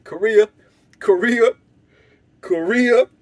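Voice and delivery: loud, rising calls of the name; insistent repeated shout; calling out. Retold or imitated. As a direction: summon him urgently across the yard. Calling friend urgently.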